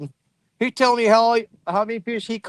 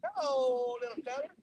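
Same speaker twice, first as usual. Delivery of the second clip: tender greeting; 3 semitones higher, 16 dB quieter than usual. Real, not acted. Greeting a small caught fish.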